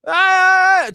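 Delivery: high, loud mock wail of a prayer call. Mocking the call to prayer, a high loud wail.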